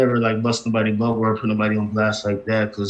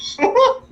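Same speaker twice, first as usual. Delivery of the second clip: short high laugh; 3 dB louder than usual. Real, not acted. Laughs at "Really?.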